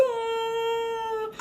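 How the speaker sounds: high mock wail. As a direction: wail out like a sad cartoon zebra. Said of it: "Zelda started wailing".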